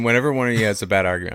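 usual speaking voice

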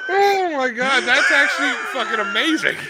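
high-pitched, tickled exclamation; real amused delight. Real, not acted. Co-host delighted by a stupid menu pun, laughing exclamation. Cracking up at the joke.

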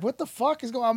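high, stunned what-the-hell; baffled at being recognized in Japan; amazed disbelief. Retold or imitated. A musician recalling fans in Japan, high amazed disbelief. Retold shock at fame in Japan.

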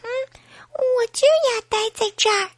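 put-on high-pitched stubborn baby voice; small voice insisting; insistent baby. A narrator's reading. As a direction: dig in like a little child, up high. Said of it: "宝宝坚持地说".

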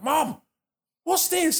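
put-on high, loud child's surprise; child finding a hidden Nintendo, thrilled; excited kid. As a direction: squeal like a kid finding a present. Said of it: "can you imagine a kid like".

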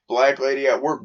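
ordinary talk, nothing marked

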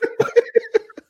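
high breathy burst of laughter; real laugh. Real, not acted. Remembering leaving his cousin behind, a high real laugh. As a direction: laugh out at the memory.

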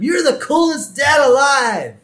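high, loud imitated boy's cheer; yell. Son cheering on his dad, yelling. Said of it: "And Bart yells at him".

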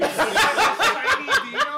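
loud, high, stretched laughing question; cracking up in disbelief. Real, not acted. Incredulous laugh repeating insult.

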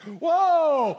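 loud, high startled cry; startled whoa. Retold or imitated. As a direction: yelp as if juice hit you. Shirt sprayed by tomato.

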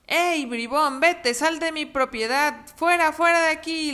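loud, high chasing-off yell; angry farmer shout. Acted. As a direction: shout the fox off the farm. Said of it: "le dijo el granjero". (grito furioso del granjero)

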